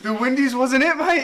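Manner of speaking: high squeaky laugh-talk; barely holding it together; laughing hysterically. Real, not acted.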